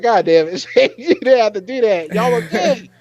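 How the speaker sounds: high, loud scolding of Shane; exasperated scolding. Retold or imitated. Scolding Shane on screen, high loud exasperated scolding. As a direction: scold him for ruining the moment. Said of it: "I was like".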